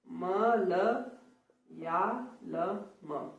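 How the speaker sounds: "मलयालम" very slow and soft. Shown as a demonstration. Teacher slowly spelling out the word. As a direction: drawl "मलयालम" slowly and quietly. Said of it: "ये मलयालम देखिए".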